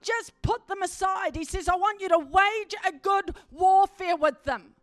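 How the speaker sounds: loud, high, fervent preacher's cry; full-force preaching. Acted. Preacher calling the congregation to cry out, loud and fervent. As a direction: preach it out, rousing the whole congregation.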